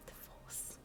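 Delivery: breathy joking aside; whisper. Real, not acted. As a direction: whisper the joke to yourself.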